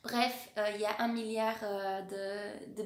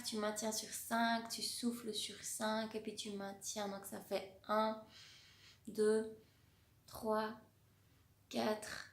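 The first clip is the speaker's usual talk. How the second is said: guided breathing count; slower than usual. Acted. Counts a square breathing exercise.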